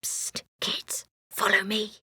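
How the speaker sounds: hushed unvoiced whisper. A narrator's reading. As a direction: whisper it secretly through the wall. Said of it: "whispered through a hole in the wall".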